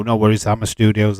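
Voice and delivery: ordinary talk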